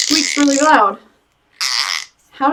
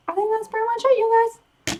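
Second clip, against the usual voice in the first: high sing-song voice; 10 semitones higher, 4 dB quieter, faster than usual. Real, not acted. Repeats it, higher.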